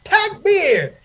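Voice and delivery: high, loud shouted Takbir; proud ringing call. Retold or imitated. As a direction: leap up and call it out. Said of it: "I jumped up and I said".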